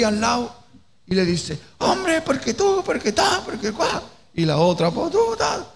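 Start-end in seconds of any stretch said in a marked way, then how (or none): 1.8-4.0 high-pitched mock argument yell. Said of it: "los dos gritando". 4.9-5.7 high-pitched retort in a mock row; sudden high mock shout; mock yelling back. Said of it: "los dos gritando".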